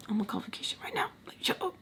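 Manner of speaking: fast, whispered aside; muttered retort. Retold or imitated. Silent comeback to the man, fast whispered mutter. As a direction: whisper it quickly under your breath.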